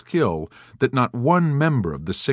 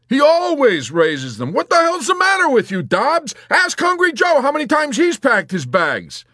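loud, high-pitched angry outburst; exasperated shouting; 7 dB louder than usual. A narrator's reading. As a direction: yell at him, out of patience. Yossarian loses patience with Dobbs.

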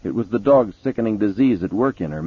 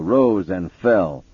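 ordinary delivery

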